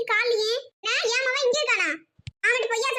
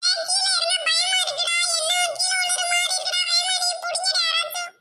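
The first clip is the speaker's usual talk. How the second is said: higher, pleading, frightened; scared up a tree; 5 semitones higher than usual. Acted. Boy stuck on palm tree begging friends for help, frightened. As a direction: beg them to catch you, voice pitched up with fear.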